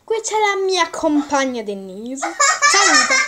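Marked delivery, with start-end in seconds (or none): 2.3-3.2 squealed high greeting. Real, not acted. Kid greeting friend on camera. (saluto squillante)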